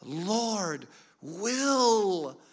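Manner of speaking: high, very slow quote of mother; imitated insistent mother. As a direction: stretch out each word as the mother would. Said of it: "she would say".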